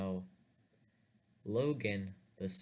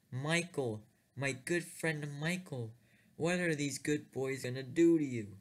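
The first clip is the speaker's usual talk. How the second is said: retold scream; 5 semitones higher, 4 dB louder than usual. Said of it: "I screamed louder than any boy had ever screamed before".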